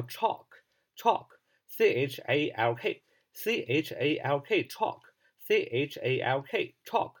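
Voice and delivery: chalk and its letters, slowly; slow spelling drill. Shown as a demonstration. Teacher slowly spells chalk for students. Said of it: "粉笔怎么说呢".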